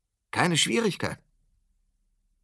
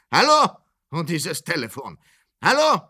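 shouting into the phone; 3 semitones higher, 3 dB louder than usual. Acted. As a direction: call into the dead line. Shouting down a bad phone line.

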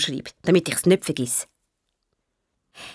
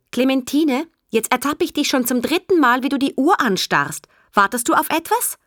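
teacher's reprimand; 6 semitones higher than usual. Acted. Teacher catches child staring at clock.

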